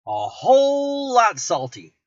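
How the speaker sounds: "a whole lot salty" drawn out slow and high, leaning on "whole lot"; emphatic correction. Real, not acted. Correcting himself on the salty dish, slow emphatic stress. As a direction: stretch out "a whole lot" to make the point.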